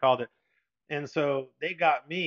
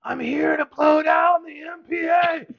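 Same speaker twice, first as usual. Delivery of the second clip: slow, much higher, loud yell over a bad line; screaming into the headset; 13 semitones higher, 8 dB louder, slower than usual. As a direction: shout it through the noise of the engine room. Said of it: "you're, like, screaming to the guy".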